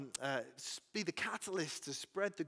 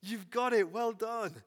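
laughing, rising to a louder cheer; playful Jesus praising; 2 semitones higher, 5 dB louder than usual. Pretend. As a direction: cheer him on like a delighted teacher. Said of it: "I wonder if Jesus just went".

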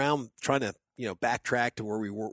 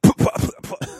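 breathy sputtering puffs, louder, no voice; imitated choking splutter; 8 dB louder than usual. Retelling finding the drone, mock choking sputter. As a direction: spit out water in shock. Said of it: "almost choke on my water".

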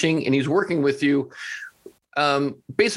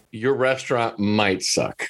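slow punchline; 5 semitones lower, slower than usual. Real, not acted. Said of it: "punchline to critics".

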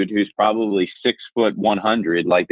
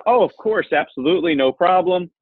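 much higher, eager to please; nervous eager agreement; 10 semitones higher than usual. Retold or imitated. Young salesman placating a huge gruff client, nervous high-pitched agreement. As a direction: squeak out a nervous yes to smooth the moment. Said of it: "So I just kinda said".

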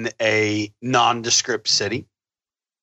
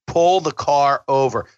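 slow, louder, spaced-out order; slow and a bit louder, final warning; slow emphatic demand; 3 semitones higher, 3 dB louder, slower than usual. Retold or imitated. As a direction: lay down the law word by word. Retold demanding driver stop.

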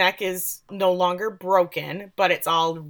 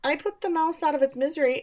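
higher, teasing mock priest; a hint of smug confession; sly priest; 9 semitones higher than usual. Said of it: "And Father says".

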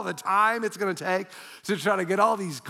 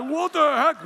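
astonished; 8 semitones higher, 5 dB louder than usual. Retold or imitated. Money fell out of letter.

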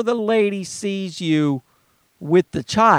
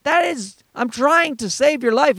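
exasperated plea; 9 semitones higher than usual. Retold or imitated. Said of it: "And I'm like".

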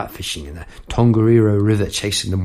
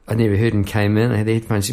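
usual speaking voice

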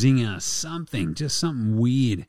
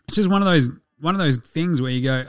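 usual speaking voice

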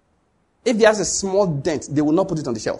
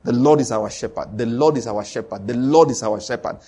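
declaring; 6 semitones lower than usual. Shown as a demonstration. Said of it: "You keep on declaring".

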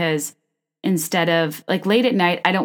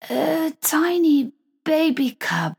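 high, drawn-out bad celebrity impression; hammed-up slow, high gushing; Lisa Vanderpump; 10 semitones higher, slower than usual. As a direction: coo it slowly in a put-on high voice. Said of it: "I can't do impressions".

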